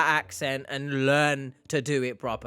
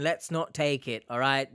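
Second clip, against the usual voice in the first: ordinary talk, nothing marked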